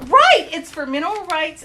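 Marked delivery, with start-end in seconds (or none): 0.1-0.5 high, loud sharp agreement; exclaimed agreement. Real, not acted. Agreeing loudly with her friend, high and emphatic. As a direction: burst out in agreement.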